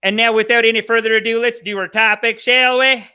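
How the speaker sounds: loud, high-pitched host announcement; hyped show kickoff. Real, not acted. Host launching the topic after thanks, hyped and loud. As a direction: belt out the intro to launch the show.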